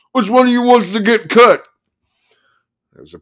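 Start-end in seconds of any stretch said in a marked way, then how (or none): 0.1-1.7 vicious loud snarl. Acted. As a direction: snarl it at the crowd. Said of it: "he snarled".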